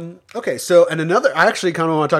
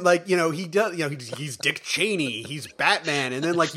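ordinary talk

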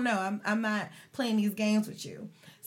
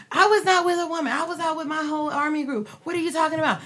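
put-on defensive boyfriend; 6 semitones higher, 4 dB louder, faster than usual. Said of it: "I said".

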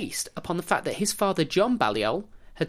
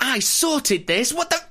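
outraged king; 9 semitones higher, 4 dB louder, slower than usual. Retold or imitated. Impersonating enraged King Edward.

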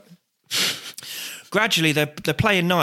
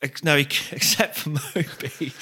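ordinary delivery

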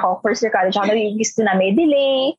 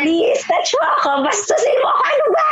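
exaggerated begging, higher pitched; hammed-up pleading; 12 semitones higher, faster than usual. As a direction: ham up a desperate plea.

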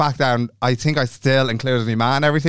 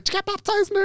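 much higher, softer clueless question; high naive voice; 5 dB quieter, faster than usual. Retold or imitated. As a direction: ask it wide-eyed and squeaky. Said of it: "I was like".

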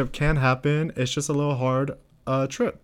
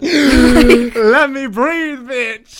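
high, loud and very slow, laughing it out; begging for air through laughter; laughing exasperated plea; 12 semitones higher, 8 dB louder, much slower than usual. Real, not acted. Overwhelmed by her friend's advice on the pod, laughing plea.

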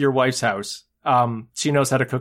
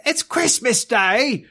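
put-on high, drawn-out childlike reply; mock street kid; 8 semitones higher, much slower than usual. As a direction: chirp it like an eager urchin. Boy answering Scrooge from street.

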